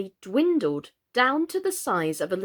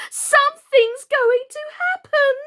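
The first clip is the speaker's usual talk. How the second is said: screaming warning; 7 dB louder than usual. A narrator's reading. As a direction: shriek a frantic warning.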